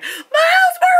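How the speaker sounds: high squealing cry of the name; excited kid shout. Retold or imitated. Kids in the theater shouting the name, high and thrilled. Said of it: "some kids who were like".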